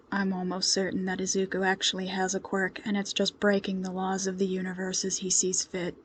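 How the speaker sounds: low, soft, dry deadpan; a quiet stoic man's deadpan quip; stoic deadpan hero. A narrator's reading. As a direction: deliver the joke bone-dry. Stoic Stormfront makes a joke.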